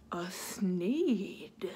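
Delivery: hushed low reveal after a gasp; whisper. A narrator's reading. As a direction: lean in and whisper it.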